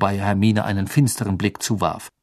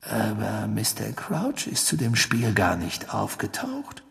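soft, slow questioning; Sirius, probing; 8 dB quieter than usual. Acted. Sirius questions the three about Crouch, probing. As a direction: probe slowly in a hushed voice.

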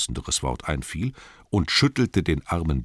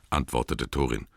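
ordinary delivery

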